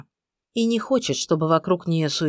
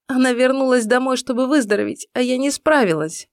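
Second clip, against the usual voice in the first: высоко, со слезами в голосе; надломленно оправдывайся; в слезах; 8 semitones higher, 3 dB louder than usual. A narrator's reading. Иден плачет, винит себя. (сквозь слёзы)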